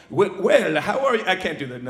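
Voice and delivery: halting attempt at South African; failed South African accent. He voices South African Nikita at dinner, botched South African accent. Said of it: "the South African Afri-, uh, accent".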